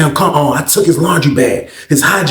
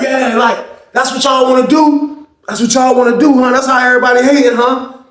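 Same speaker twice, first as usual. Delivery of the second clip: indignant rant; 9 semitones higher than usual. Retold or imitated. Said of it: "He like".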